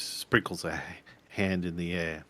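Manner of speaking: ordinary talk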